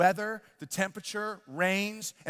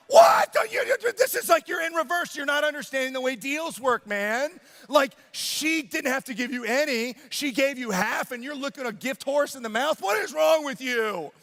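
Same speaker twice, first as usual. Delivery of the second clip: high, loud outburst settling into worked-up scolding; hammed-up incredulous rant, starting with a shout; exasperated parent; 7 semitones higher, 4 dB louder than usual. Dad scolds ungrateful son, exasperated rant.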